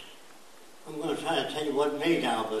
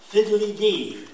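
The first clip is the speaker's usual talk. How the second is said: higher, louder prissy little exclamation; mock the polite curse; prim mild curse; 6 semitones higher, 8 dB louder, slower than usual. Prim baker who dropped a pie, prissy exclamation.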